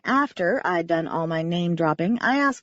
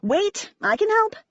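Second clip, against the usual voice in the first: raised, high-pitched urgent plea; pitched-up shout of offered help; urgent calling out; 11 semitones higher, slower than usual. A narrator's reading. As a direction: call out to stop them. Calling out to a panicking acrobat.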